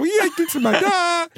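high, squeaky playful little voice; squeaky silly voice. Pretend. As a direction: pipe it up like a tiny silly man. Jokey voice of tiny man inside.

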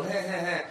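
high mock chuckle; fake laugh. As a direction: imitate a forced laugh. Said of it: "You don't go".

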